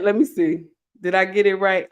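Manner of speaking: ordinary talk, nothing marked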